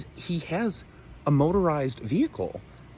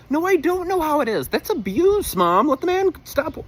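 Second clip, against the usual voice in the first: much higher and louder outburst; exasperated rant; 9 dB louder than usual. Real, not acted. Son ranting at mom about dad working, loud and high. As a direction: scold her like it's outrageous.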